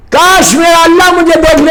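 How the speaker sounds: full-voiced preacher's cry; impassioned exclamation. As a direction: proclaim the imam's wish to the whole gathering. Preacher repeats the wish out loud. (بلند، پرجوش خطیبانہ پکار)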